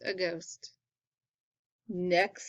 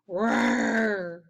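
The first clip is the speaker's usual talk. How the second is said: a mock monster roar; 3 dB louder than usual. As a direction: growl it out like a storybook beast. Monster appears in the story.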